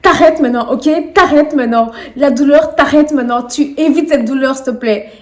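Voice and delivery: shouting at God from a hospital bed; raging at God. Retold or imitated. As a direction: demand that God stop the pain. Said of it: "j'ai commencé à péter un câble sur Dieu".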